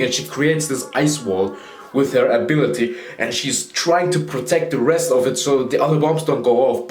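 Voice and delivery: usual speaking voice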